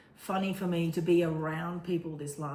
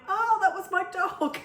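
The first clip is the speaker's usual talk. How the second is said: high, loud cry of recognition; retold outburst, voice leaping up; emotional realisation; 14 semitones higher, 6 dB louder than usual. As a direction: blurt it out high and loud, as if it just hit you. Retells her reaction to the psychic.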